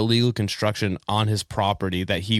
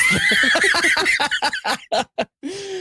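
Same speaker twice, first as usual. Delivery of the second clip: high shouting laugh; loud laughing shout. Real, not acted. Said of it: "a [name] impression".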